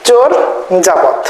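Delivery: চোরযাপদ as chor, pause, japod; split pronunciation. Shown as a demonstration. Teacher repeating the corrected form, split after the first syllable. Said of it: "আসলে চর্যাপদ না".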